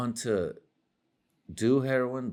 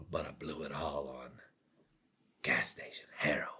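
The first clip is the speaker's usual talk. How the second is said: unvoiced, lowered whisper of shame; whispered confession; 6 semitones lower, 10 dB quieter than usual. Ruined man confessing where his money went, whispered. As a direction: breathe it out like a dirty secret.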